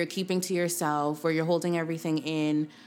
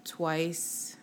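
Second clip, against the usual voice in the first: guided meditation. Acted. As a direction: guide them gently through each breath. Leads a breathing meditation.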